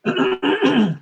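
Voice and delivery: clearing a hoarse throat. Real, not acted. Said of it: "lose my voice here".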